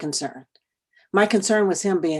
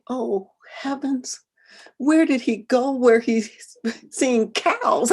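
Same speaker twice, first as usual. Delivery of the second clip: slow, higher, with a little laugh; incredulous thought; 6 semitones higher, slower than usual. Retold or imitated. Mother wondering where her son could be, slow, amused disbelief. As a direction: marvel in disbelief, half laughing.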